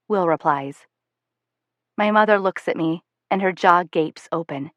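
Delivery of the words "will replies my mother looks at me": usual speaking voice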